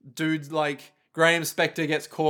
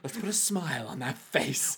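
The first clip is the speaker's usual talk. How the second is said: Joker; 7 dB quieter than usual. Acted. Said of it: "I'm the Joker".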